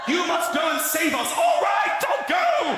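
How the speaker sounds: panic, then take it all back; wife sending her husband off then calling him back, shrill panic; panicked shout. Acted.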